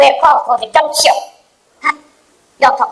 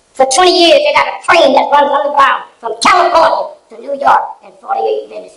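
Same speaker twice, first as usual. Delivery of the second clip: fiery preaching; 7 semitones higher than usual. Acted. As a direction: preach it at them, building heat. Preaching at the audience.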